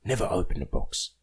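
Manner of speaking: put-on film-quote Cockney accent, punchy start; Jason Statham. Quoting the Transporter's rules for friends, mock Cockney accent. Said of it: "My name's Jason Statham".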